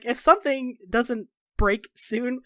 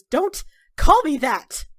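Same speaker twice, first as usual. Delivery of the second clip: sharp, raised rebuke, stretched out; indignant outburst; angry protest; 6 semitones higher, 8 dB louder, slower than usual. Acted. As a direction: snap it as an order to stop. Acted protest at a nickname.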